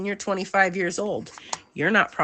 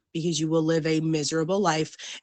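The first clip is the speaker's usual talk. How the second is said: usual speaking voice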